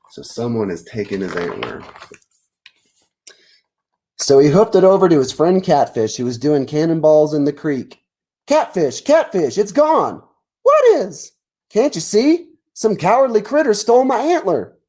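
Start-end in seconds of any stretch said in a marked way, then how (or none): 4.2-8.0 lively read-aloud narration, a touch higher; story narration. A narrator's reading. 8.5-14.8 high, urgent cartoon deer calling for his friend; upset deer. Deer calls to Catfish.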